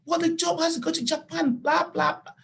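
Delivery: high-pitched mimicry of a nagging boss; mocking imitation. Volunteer imitating boss about the job for Japan, mocking. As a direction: parrot the boss's complaint dismissively, way up high. Mimics boss's complaint, dismissing it.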